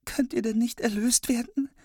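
put-on very high, tearful little girl. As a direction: ask it like a crying small girl. Sister asks, weeping.

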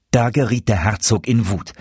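usual speaking voice

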